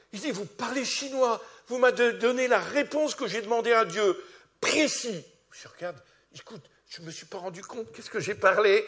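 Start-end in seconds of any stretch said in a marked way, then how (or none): 0.3-5.2 high, loud astonished outburst; imitating the stunned student, loud and high; amazed exclamation. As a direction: cry it out as if you can't believe it. Retelling the amazed Chinese student.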